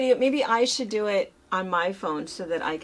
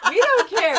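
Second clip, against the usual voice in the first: higher, louder mock yell; imitated yelling; 7 semitones higher, 4 dB louder than usual. Wife imitating her husband yelling at the smart speaker, mock yell. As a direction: snap at the device like a grumpy husband. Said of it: "he'll just start yelling".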